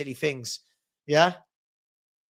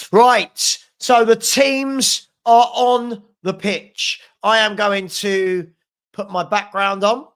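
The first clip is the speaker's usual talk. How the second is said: loud, raised, slow announcer call; big, spaced-out kickoff announcement; announcing; 3 semitones higher, 7 dB louder, slower than usual. Real, not acted. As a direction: call it out slowly to the whole audience. Announcing teams are on the pitch.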